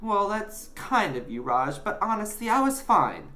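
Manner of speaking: put-on high boyish reassurance; lying politely. A narrator's reading. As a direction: put on being fine. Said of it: "lied Alfie".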